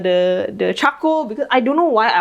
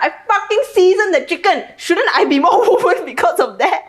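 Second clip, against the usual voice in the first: much higher, laughing mock protest; high mock outrage; 10 semitones higher than usual. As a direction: squeal it in playful outrage. Camping up the cooking bit.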